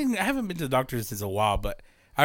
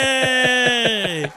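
ironic cheer; 10 semitones higher, 4 dB louder than usual. Acted. Mock cheer over pre-diabetic news.

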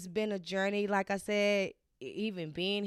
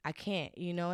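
ordinary talk

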